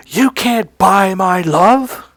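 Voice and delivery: slow, high indignant declaration; reenacted outrage, each word drawn out; pissed. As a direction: stretch out an angry retort. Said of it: "And I was pissed".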